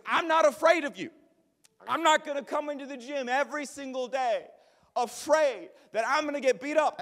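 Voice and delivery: 高声愤怒地逞强; high, loud defiant rant; angry defiance. Retold or imitated. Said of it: "He goes".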